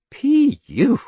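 high, disgusted P U; disgusted squeal. A narrator's reading. Girl reacts to the smell.

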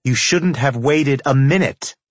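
high-pitched, pointed scolding; female character. A narrator's reading. Woman character's reproachful reply in narrated scene.

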